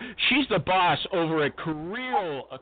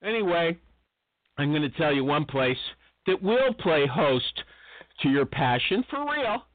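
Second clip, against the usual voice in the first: normal talking voice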